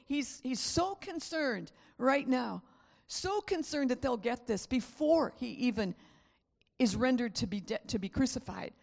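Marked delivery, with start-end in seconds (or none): none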